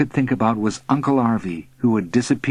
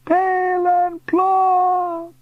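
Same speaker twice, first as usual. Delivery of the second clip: very high, slow, fading cry; thin echo; much slower than usual. A narrator's reading. Uncle vanishing into clouds, faint echo. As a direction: let it drift down from far away. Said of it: "A thin echo drifted down".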